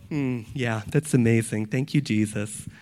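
low, understated, offhand murmur of approval; mock casual acceptance. Preacher imitating someone skimming scripture, low and underwhelmed. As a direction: imitate an unmoved reader's mild praise. Mimics a casual, unmoved reader.

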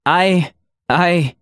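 hesitant stammer; stuttering. A narrator's reading. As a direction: stumble over the first word before getting it out. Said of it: "she stuttered".

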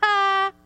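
long "ah" breaking into ha-ha-ha; put-on relieved, gloating laughter; mock laugh. Preacher acts realising the dog is limited, mock laughing. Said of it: "you start laughing at that mean dog".

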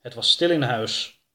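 usual speaking voice

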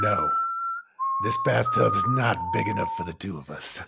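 high, mock gunslinger voice; a parody cowboy stare-down line; western standoff line. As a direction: deliver it like a showdown in a saloon. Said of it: "It's a fucking Mexican standoff".

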